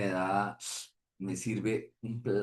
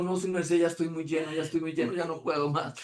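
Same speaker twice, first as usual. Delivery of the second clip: a touch higher, begging off politely; polite pleading; 6 semitones higher than usual. Retold or imitated. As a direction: plead you are full, gently. Pleading refusal of food. (súplica cortés y algo aguda)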